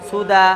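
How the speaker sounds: সুদা with final aa held, no n; pausal elongation. Shown as a demonstration. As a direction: stretch the end of সুদা and stop. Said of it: "এক আলিফ পরিমাণ টান দিয়ে".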